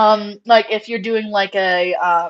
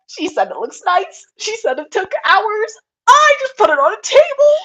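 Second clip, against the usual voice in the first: high tearful squeal building louder; overexcited meltdown; 12 semitones higher, 7 dB louder than usual. Retold or imitated. Breeder losing it over mentor's praise, overexcited. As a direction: squeal it, rising into a hammed-up wail. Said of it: "I was so excited".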